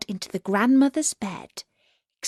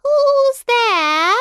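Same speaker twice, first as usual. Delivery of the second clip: put-on high, loud old woman's call; grandmother calling; 8 dB louder than usual. Sick grandmother calling high through the door.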